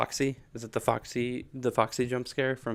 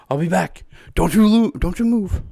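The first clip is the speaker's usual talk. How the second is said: higher, louder, playful menace; mock threat; 7 semitones higher, 7 dB louder than usual. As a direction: boss someone around in jest. Acting animatronic threat.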